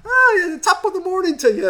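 high, bright mock Irish greeting; chirpy neighbour. Mocking a happy dog-walking couple with a chirpy greeting. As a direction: ham up a cheery passer-by's hello. Mimicking cheerful passing couple.

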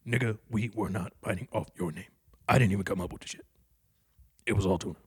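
low, quiet, lightly done comedian voice; a hint of a gruff comic; Paul Mooney impression. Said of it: "Paul Mooney".

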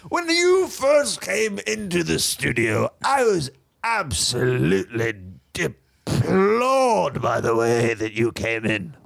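drawn-out, affected disapproval; haughty millionaire confessing distaste; pompous rich bachelor. Acted. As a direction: stretch key words like a snob.

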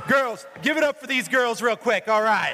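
high, loud hype to the crowd; rousing high-pitched shout; shouted applause call. Real, not acted. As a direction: shout to get the room clapping. Calling for applause over crowd.

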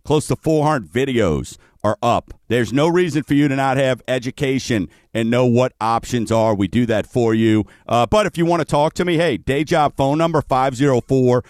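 usual speaking voice

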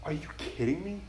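hushed, incredulous retort; softly acted incredulity; disbelief. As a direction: quietly voice David's stunned disbelief. David voiced in disbelief at Goliath.

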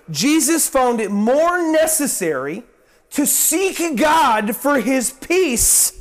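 ordinary delivery

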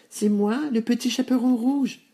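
put-on very high little-girl voice; high-pitched child answering at the door. A narrator's reading. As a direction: pipe up high, like a small child. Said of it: "répondit-elle".

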